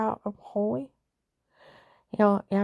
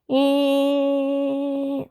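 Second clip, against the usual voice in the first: imitated hum; 5 semitones higher, 5 dB louder than usual. [name] mimics humming aids.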